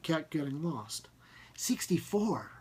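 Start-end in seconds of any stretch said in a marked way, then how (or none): 1.6-2.5 hushed uncertain answer. Acted. Farnsworth unsure of the count.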